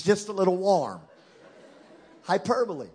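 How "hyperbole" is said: ordinary talk, nothing marked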